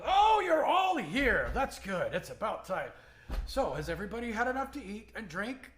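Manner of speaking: loud, high opening cry, easing down; dwarf host. Acted. Dwarf walks in to greet the guests, hearty host. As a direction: boom out a big welcome, then settle. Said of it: "He walks in and goes".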